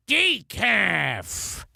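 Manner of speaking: one-word monster groan; Karloff monster. Acted. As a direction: draw out the word like an old movie monster. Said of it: "sort of like Karloff saying the one word".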